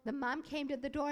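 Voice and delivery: ordinary delivery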